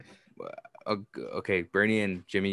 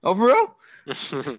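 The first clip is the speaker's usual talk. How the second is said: mock echo; 8 semitones higher, much slower than usual. Host echoes a player's reaction.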